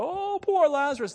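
high, loud, drawn-out show of grief; hammed-up wail of pity; mock wailing. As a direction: put on a big show of mourning. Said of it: "Jesus wailing and putting on this show".

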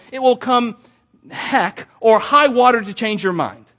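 preacher hammering his theme to the congregation; full-force preaching. Acted. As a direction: press the lesson home from the pulpit. Preacher drives home his point.

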